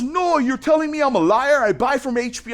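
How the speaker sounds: high-pitched mock indignation; imitating angry customer. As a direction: protest like an offended client. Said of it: "So he's cussing me out".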